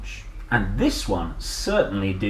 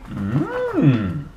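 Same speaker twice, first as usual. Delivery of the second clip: plain reading voice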